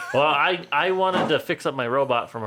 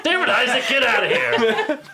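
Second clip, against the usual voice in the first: high, loud mock scolding; laughing mock outburst; 10 semitones higher, 5 dB louder than usual. [name] chases Isaac out of the room, laughing mock outburst. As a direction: shout him away while cracking up.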